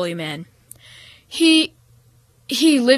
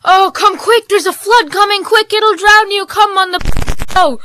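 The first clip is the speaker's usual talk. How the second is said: high, loud urgent warning shout; yelling; 10 semitones higher, 11 dB louder than usual. A narrator's reading. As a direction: call him out of the hut before the flood. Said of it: "the man yelled".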